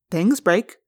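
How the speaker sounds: ordinary delivery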